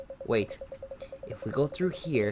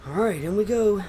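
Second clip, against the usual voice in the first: higher and louder, gung-ho; excited rallying cry; 9 semitones higher, 8 dB louder than usual. Acted. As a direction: pump the team up to go in. Rallying group to go down.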